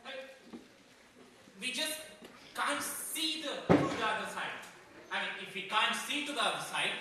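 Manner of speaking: aggrieved guard dog pleading his case; proud, indignant Rottweiler telling his side; Boris. Acted. Rottweiler defends himself to the humans, aggrieved and emphatic.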